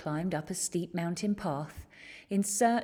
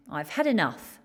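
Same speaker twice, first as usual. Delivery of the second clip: fed up; 5 dB louder than usual. A narrator's reading. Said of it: "he cried".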